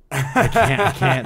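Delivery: laughing and loud; breaking into laughter. Real, not acted. Laughing at the echo.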